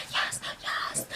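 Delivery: whispered under the breath. Retold or imitated. Morgan overhearing Chrissy in the kitchen, whispered. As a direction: whisper agreement to yourself.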